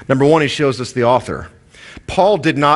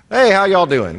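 lightly acted, higher-pitched Southern hello; throwaway Southern greeting; 8 semitones higher than usual. Preacher imitating Southerners just being nice, throwaway greeting. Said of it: "little throwaway greeting we do in the South".

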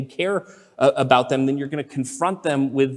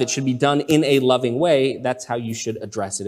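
ordinary talk

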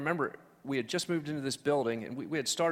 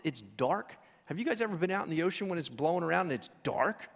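normal talking voice

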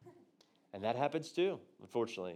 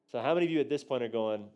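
ordinary delivery

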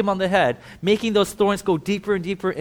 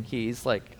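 usual speaking voice

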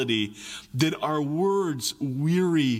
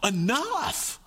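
drawn-out, higher, slow sigh of enough; fed up; 7 semitones higher, much slower than usual. Retold or imitated. Parent worn out by whining, slow fed-up sigh. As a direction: let it out like a parent at the end of their rope. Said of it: "it's almost frustrating".

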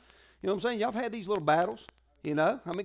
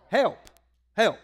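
ordinary talk

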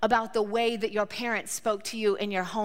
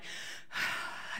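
long calming sigh; 11 dB quieter than usual. Retold or imitated. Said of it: "I breathe myself".

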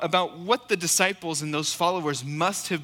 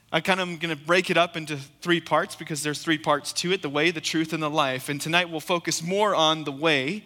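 ordinary delivery

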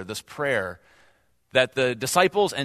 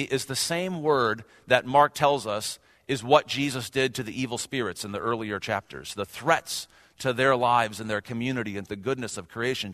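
ordinary talk, nothing marked

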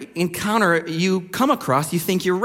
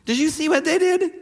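mock gossip; 5 semitones higher than usual. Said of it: "go home and go".

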